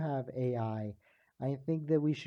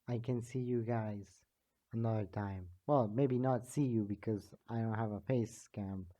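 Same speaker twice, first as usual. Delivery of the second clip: ordinary talk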